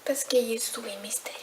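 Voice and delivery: mysterious whisper; hushed, secretive aside. Real, not acted.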